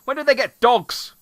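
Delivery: loud startled disbelief; shocked outburst. Pretend. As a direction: yell it like you just got home. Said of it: "come back and be like".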